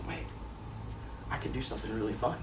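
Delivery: put-on hushed, low voice for a kid's sly idea; thinking to himself. Boy thinks up a prank outside the door, acted hushed thought. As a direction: drop very soft and low, as if scheming. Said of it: "he's thinks to himself for a second".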